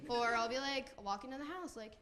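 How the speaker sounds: ordinary talk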